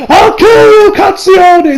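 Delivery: very high shouted death threat; over-the-top enraged yell; mock ranting. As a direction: scream it like a hammed-up anime villain. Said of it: "the dude then from here just starts ranting".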